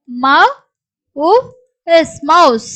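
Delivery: isolated sounds with long pauses; sounding out. Shown as a demonstration. Teacher showing where the S sound comes, sounded out. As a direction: break it up, then blend. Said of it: "எஸ் உச்சரிப்பு தான் வருது".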